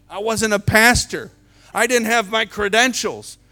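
loud, high, driving sermon delivery; fiery full-volume preaching; preaching at full force. Acted. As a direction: preach it out to the back row.

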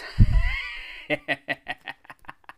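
very high cackle dying away; hammed-up cackle. Reader caps the story's joke, hammed-up cackle. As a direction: cackle after your own punchline. Laugh after the punchline.

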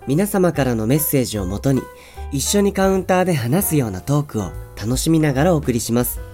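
upbeat radio announcer opening; radio host intro. Acted. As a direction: read it like a show opener. (ラジオ番組のオープニング口調)

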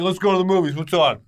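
3:play a bored filmgoer looking for something to see; 1:typical moviegoer. Retold or imitated. 4:host imitating people picking a movie, lightly voiced upbeat moviegoer. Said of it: "A lot of people are like".

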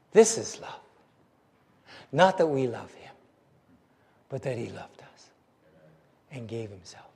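soft, low, with long pauses; hushed reverence. Acted. Preacher quotes John's most wonderful words, hushed and reverent. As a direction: let each phrase sink away quietly.

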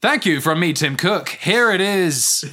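lightly voiced tech CEO, slow reveal; hint of a keynote presenter, hammed up; Tim Cook impression. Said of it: "I don't know how Tim Cook talks".

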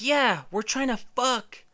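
high, drawn-out mock yell; imitating a girl backing him up; girl hollering agreement. Girl in the slide backing up her partner, yelling.

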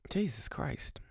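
whispered under the breath; a whispered groan of disbelief; exasperated whisper. Real, not acted.

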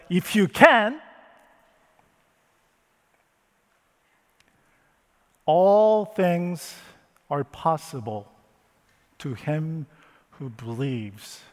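a touch of upset, rising then fading. Acted. Jesus answering the doubting father, mildly upset. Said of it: "He also got upset".

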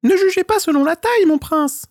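very high, squeaky little squirrel voice pleading. A narrator's reading. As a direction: beg like a tiny trapped squirrel. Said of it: "répondit l'écureuil". (petite voix aiguë d'écureuil suppliant)

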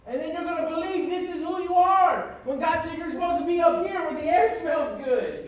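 preaching at full force. Acted. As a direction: drive it home to the church. Preaching climax to congregation.

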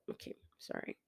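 embarrassed whispered aside; whispering. Real, not acted. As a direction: drop to a breathy whisper. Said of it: "like, whispering".

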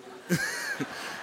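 sudden squealing laugh; real burst of high laughter. Real, not acted. Laughs at sleeping church member Silva.

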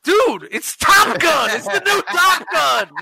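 can't believe he missed Top Gun; excited disbelief. Real, not acted.

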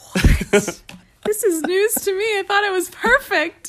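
high, loud laughing disbelief; shocked laugh. Real, not acted. Wife hearing husband's secret annoyance, laughing high. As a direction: squeal it in delighted shock.

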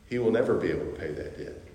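usual speaking voice

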